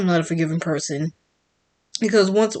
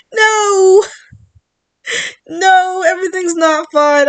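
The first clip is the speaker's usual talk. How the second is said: loud cry of no; 11 semitones higher, 7 dB louder, slower than usual. Real, not acted. Outburst rejecting the mocked line.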